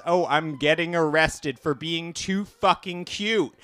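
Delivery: ordinary talk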